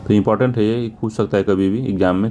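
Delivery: ordinary delivery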